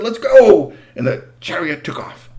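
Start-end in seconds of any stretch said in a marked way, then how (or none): 0.0-0.7 loud, high cry to the horses; rally the team with a shout; eager shout. Acted. Boy driving the chariot shouts to the horses. Phaethon snaps the reins.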